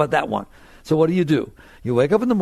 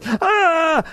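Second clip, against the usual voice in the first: high mock scream; high, alarmed "aah"; mind's scream; 12 semitones higher, 4 dB louder than usual. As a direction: cry out as the panicking mind. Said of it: "So it goes".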